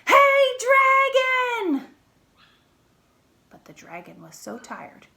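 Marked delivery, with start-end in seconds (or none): 0.1-1.9 very high, very loud yell into the ear; shouted. Acted. Princess yelling into the dragon's ear, full shout. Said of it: "shouted as loud as she could".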